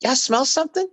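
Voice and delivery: high sing-song build, climbing to a squeal; catchphrase voice. Retold or imitated. Actor demonstrating a hook he invented, high climbing character voice. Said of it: "I came up also with".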